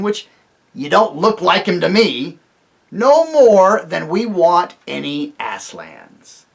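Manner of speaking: loud, defiant dwarf speech. Acted. Griffle tells Tirian they want no kings, loud and defiant. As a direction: declare independence.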